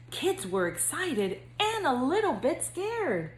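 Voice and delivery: lively singsong storybook reading; reading to children. A narrator's reading. Counselor reading to future kindergartners, bouncy storybook narration. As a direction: read it like bedtime story time for little kids. Reads picture book to kindergartners.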